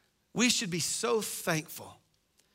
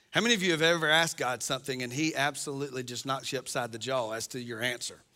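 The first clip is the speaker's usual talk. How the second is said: ordinary talk, nothing marked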